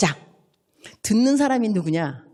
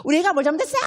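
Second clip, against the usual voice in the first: higher, sharp demand to the teacher; indignant parent; 8 semitones higher, 3 dB louder, much faster than usual. Retold or imitated. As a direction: confront the teacher defensively. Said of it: "선생님을 만나면 달라집니다". (선생님에게 따지는 항의조)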